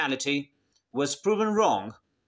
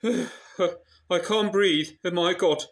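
mock overwhelmed; 2 semitones higher, 3 dB louder, slower than usual. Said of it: "getting emotional".

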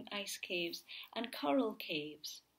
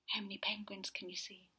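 whisper; 6 dB quieter, faster than usual. Real, not acted. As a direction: lean in and whisper the question. Aside to the child, hushed.

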